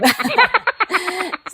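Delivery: high-pitched real giggle; high giggle breaking out. Real, not acted. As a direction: giggle at your own joke. Laughing after teasing.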